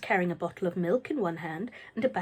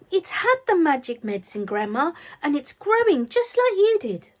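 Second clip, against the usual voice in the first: excited shout; 7 semitones higher, 6 dB louder than usual. A narrator's reading. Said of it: "and shouting".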